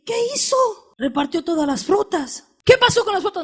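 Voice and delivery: high, startled mother asking; a mother's shocked question. Retold or imitated. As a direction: gasp at the missing fruit.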